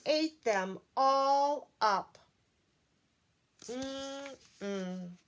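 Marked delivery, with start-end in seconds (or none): 3.6-5.2 a quiet satisfied mm-mm; soft contented yummy hum. Acted. Storyteller hums softly as the monster eats. Hungry thing eating.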